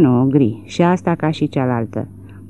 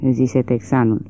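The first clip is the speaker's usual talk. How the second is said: usual speaking voice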